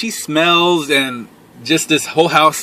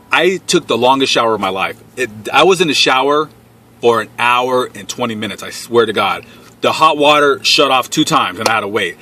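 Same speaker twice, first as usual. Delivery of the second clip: usual speaking voice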